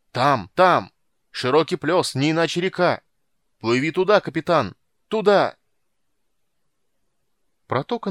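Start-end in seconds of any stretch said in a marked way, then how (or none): 0.1-5.6 high, loud excited directions; shouting. A narrator's reading. Lookout points captain toward the river, excited shouting. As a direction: yell the way from the bow. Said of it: "крикнул с носа Фиш".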